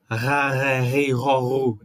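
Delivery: throaty Rá ré rei ró rô; original guttural Resh. Shown as a demonstration. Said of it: "esse seria o Resh original".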